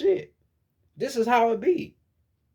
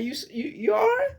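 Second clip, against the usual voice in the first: mock concerned friend; 7 semitones higher than usual. Friends checking on crying sister.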